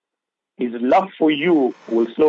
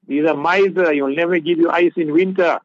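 higher-pitched mock grumbling wife; imitating complaining wives; 5 semitones higher, 3 dB louder than usual. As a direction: put on a wife running down her husband. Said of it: "ladies speaking like this".

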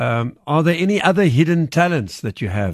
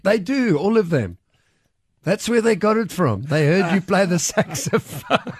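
laughing while teasing; 6 semitones higher than usual. Real, not acted. Teasing guest after beeps.

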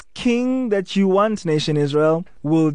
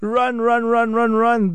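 high, urgent calling out to wake them up; pleading alarm, pitched high; urgent mock warning; 6 semitones higher than usual. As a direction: shake them awake with your voice. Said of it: "I wish I could jump in and just slap the nation Israel".

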